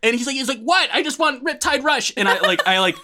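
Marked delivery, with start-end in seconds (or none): 0.6-2.1 high, laughing imitation of a clueless kid. Intruder kid explains himself to homeowner, high laughing kid voice. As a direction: play the innocent little intruder, pitched way up.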